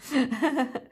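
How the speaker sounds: short self-conscious laugh at her own stumbling; real sheepish chuckle; embarrassed laugh. Real, not acted. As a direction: laugh off the fumble. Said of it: "J'en bafouille tellement".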